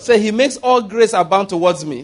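rally the church to shout back each line; preaching at full force. Acted. Pastor leading the congregation's confession, preaching hard.

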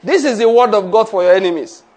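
loud, emphatic proclamation; a rousing pulpit declaration. Shown as a demonstration. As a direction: declare it over the enemies. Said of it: "Say it after me".

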